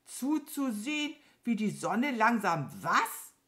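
hohes, gedehntes Nachfragen mit betontem „was“; ungläubig nachsprechen, „was“ am Ende hochziehen; langsam, ungläubig. A narrator's reading. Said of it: "Dann sagte sie langsam". (langsam und entgeistert)

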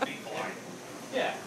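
ordinary talk

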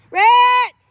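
loud high call across the yard. Real, not acted. Girl calling her puppy Ritz from across the yard, loud call. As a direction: shout the dog's name to call her.

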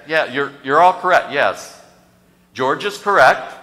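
ordinary delivery

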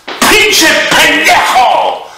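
high, loud, slow drawn-out yell; screeching involuntary curse; shouted swear. Pretend. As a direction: stretch the curse out at full volume. Mock tic outbursts of swearing.

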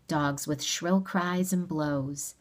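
usual speaking voice

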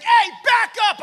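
angry shout. Retold or imitated. Masked shopper yelling at a man standing too close, loud shout. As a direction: bark it like a scared guy. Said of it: "He's like".

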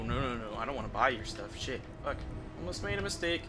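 normal talking voice

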